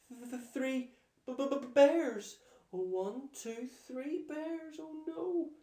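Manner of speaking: high, loud, slow panicked stammer; terrified stutter. Acted. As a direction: stammer like a girl frozen in fear. Goldilocks terrified by bears.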